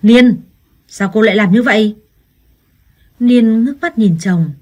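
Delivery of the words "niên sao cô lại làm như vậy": husband's loud angry yell, name barked; a husband shouting at his wife after slapping her. Acted. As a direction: bark her name loud, then demand why. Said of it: "quát".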